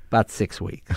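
low, understated punchline; throwaway deadpan. Retold or imitated. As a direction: toss it off lightly, without emphasis. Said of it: "just a throwaway line".

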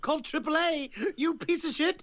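high, taunting cartoon villain. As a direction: taunt as a cackling sprite. S1 voices cackling Jack jumping away.

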